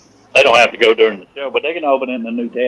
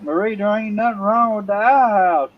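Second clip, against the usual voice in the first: higher voice calling across the yard; calling back; 7 semitones higher than usual. Acted. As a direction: yell it back to her. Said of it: "yells back".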